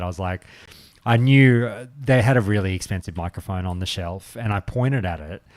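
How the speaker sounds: usual speaking voice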